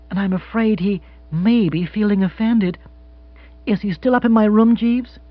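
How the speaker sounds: high, animated lady's voice; lifted, emphatic woman character; decisive wealthy widow. Acted. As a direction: gush forth grandly, easing off toward the end. Voices Mrs Spottsworth in dialogue.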